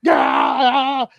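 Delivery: loud, high wrestler's cry; sudden high shouted "aah"; mock battle yell. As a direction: yell as if trading chops. Acting out chops in the ring.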